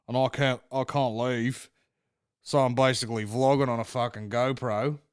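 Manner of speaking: lightly voiced Australian vlogger; a drawn-out mock Australian accent; Australian correspondent. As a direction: imitate an Australian reporter on camera. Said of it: "one of the correspondents was like".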